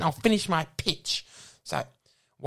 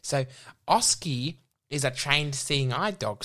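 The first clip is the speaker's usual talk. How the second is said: usual speaking voice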